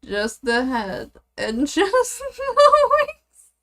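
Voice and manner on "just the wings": laughing. Real, not acted. Laughing at cursed drawing.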